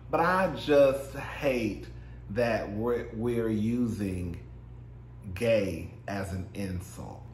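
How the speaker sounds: slow, soft and earnest; mean every word, slowly; sincere. Real, not acted. Slow sincere plea to viewers about a serious point.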